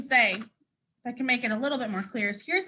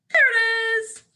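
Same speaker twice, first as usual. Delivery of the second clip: excited reveal; 9 semitones higher, 6 dB louder than usual. Real, not acted. Showing the chosen book, delighted.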